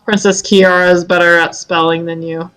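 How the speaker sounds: ordinary delivery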